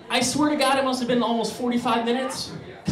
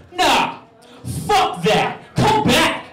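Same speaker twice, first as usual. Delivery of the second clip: loud, hammed-up call after them; mock tough yell; 6 semitones higher, 3 dB louder, much slower than usual. Narrator calling after long-gone robbers, loud mock yell. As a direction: bravely shout at the empty street.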